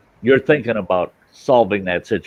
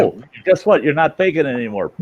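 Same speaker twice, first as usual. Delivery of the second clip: high, taunting sneer, a bit faster; mocking; 7 semitones higher, 4 dB louder than usual. Storyteller imagining taunting the fake drowner, high mocking voice. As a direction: gloat at the faker with mock glee. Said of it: "that's what I should have done and said".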